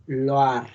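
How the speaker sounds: Loar with the oi as oa; French pronunciation. Shown as a demonstration. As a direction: model the oa sound. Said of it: "Esta I se pronuncia como una A". (Loar con la i como a)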